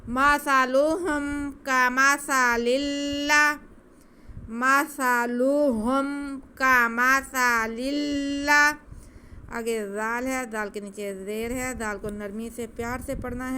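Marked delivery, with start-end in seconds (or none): 0.1-8.7 مثلهم with a soft ث and no whistle, الله joined lam to lam. Shown as a demonstration. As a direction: chant slowly, high and loud, without hissing. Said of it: "لام کو لام سے ملا دینا ہے".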